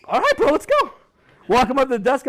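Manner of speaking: excited. Retold or imitated. Marching the kid to the desk.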